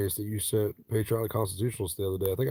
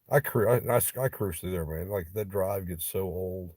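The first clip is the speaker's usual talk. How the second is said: ordinary talk, nothing marked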